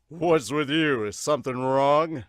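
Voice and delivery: loud, projected coach question. Acted. Coach asking if something is wrong, loud voice. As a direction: call it out loudly as the coach. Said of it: "oh, is that the coach?".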